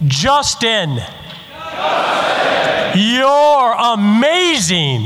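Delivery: loud, drawn-out crowd chant; booming motivational speech. Acted. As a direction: call it out to the whole room. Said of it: "repeat after me as loudly as you possibly can".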